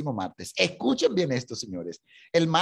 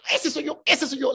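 high, eager "that's me!"; excited claim; 9 semitones higher than usual. Retold or imitated. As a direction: burst out with joyful recognition. Isaac claims the prophecy.

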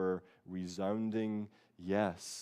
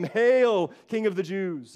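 loud, much higher sneering "Hail"; soldiers' mockery; 9 semitones higher, 10 dB louder, slower than usual. Soldiers loudly mocking Jesus as king. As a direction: hail him with loud scorn. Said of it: "the soldiers mocked him".

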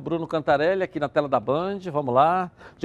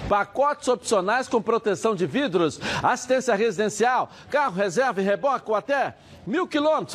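upbeat radio ad read; 3 semitones higher than usual. Acted. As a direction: sell it like a radio spot. Host reads live ad.

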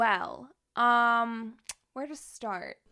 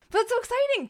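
high, louder and thrilled; excited congratulations; 12 semitones higher, 6 dB louder, faster than usual. Real, not acted. Co-host congratulates friend on getting cast, excited. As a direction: cheer a friend's news in a high voice.

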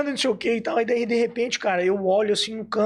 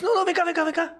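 high, eager call to come over; excited calling; 12 semitones higher, faster than usual. Retold or imitated. Friend calling him over to meet the idol, high and eager.

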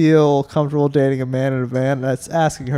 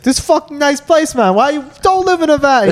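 much higher mock voice of the coworker; 11 semitones higher than usual. Coworker asking why he doesn't live in a van. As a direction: squeak it out as the puzzled coworker. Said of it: "He's like".

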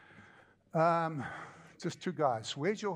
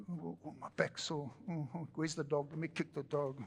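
much softer groggy morning grumble; muttering under breath; 3 semitones lower, 7 dB quieter than usual. Retold or imitated. Man waking with sore back, muttering under his breath. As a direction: grumble it to yourself half awake. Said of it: "speaking under your breath".

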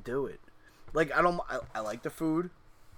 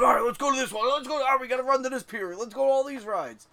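higher, excited, hurried self-imitation; mock eager; 9 semitones higher, 5 dB louder than usual. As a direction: rush everyone along to the rides.

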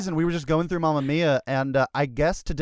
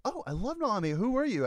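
higher, curious fan voice; lightly voiced fan; 7 semitones higher than usual. Fan meeting the actor after the show, lightly imitated eager curiosity. Acts out fans meeting him.

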